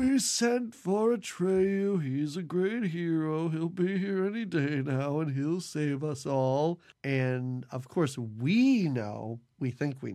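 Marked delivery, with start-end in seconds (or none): 0.0-6.8 put-on slow, light old man voice. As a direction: ease back into a frail old man. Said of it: "doing the old man voice".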